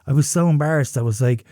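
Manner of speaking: ordinary talk, nothing marked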